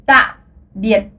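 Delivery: ta biệt, low and heavy; Vietnamese farewell. Shown as a demonstration. Teacher models a solemn ta biệt. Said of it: "vous pouvez dire".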